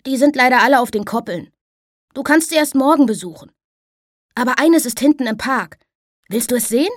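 put-on a lively boy cutting in on his father; bright boy's voice. Boy telling his father who the girl is, high and bright.

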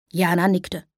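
ordinary delivery

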